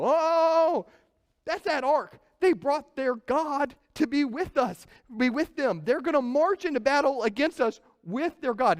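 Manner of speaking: high, loud, alarmed cry; hammed-up dread as they spot the Ark; panicking Philistines. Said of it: "enters into a panic".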